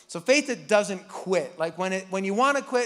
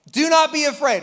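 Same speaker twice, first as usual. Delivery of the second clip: bold command; 6 semitones higher, 8 dB louder than usual. Acted. Preacher reads Moses' words.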